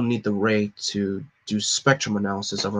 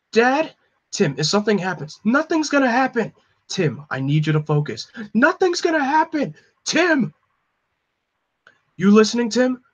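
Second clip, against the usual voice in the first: much higher, strained, frightened son; panicked cries; 11 semitones higher than usual. Acted. Son hearing the killer reach his dad, panicked cries. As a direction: cry out in rising panic.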